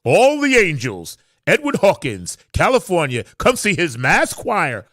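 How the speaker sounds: loud, high-pitched promo announcer voice; big showy voiceover sell. Shown as a demonstration. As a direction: boom it out like an ad read, drawing out the opening and closing words. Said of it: "I got a job doing voiceovers".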